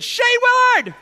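high, loud call of a name; a kid calling someone out. Retold or imitated. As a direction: shout the name across the yard. Bully calling out challenge.

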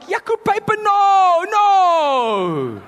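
high, loud shrieking no; panicked God. Pretend. Preacher mocks a frightened God, high panicked shout. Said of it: "He's not like".

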